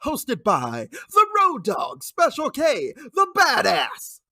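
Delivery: booming ring announcer intro; grand entrance announcement. Acted. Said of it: "that was better than I expected".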